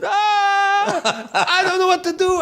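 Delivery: high, laughing mock wail; panicked wail. The colleague wailing at the border, high and laughing. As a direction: whimper it like a helpless kid. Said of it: "he said".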